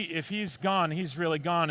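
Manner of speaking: ordinary talk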